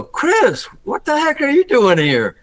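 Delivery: retold high-pitched shock; shocked outburst. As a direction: call out to a friend who just jumped you. Finds friend hiding in closet.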